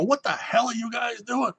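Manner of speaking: high, outraged holler, imitated; irate officer's shout. Officer at the railing to drunk sailors, retold angry shout. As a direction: call down in mock outrage.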